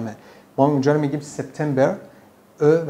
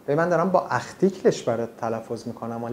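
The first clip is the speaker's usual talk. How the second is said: usual speaking voice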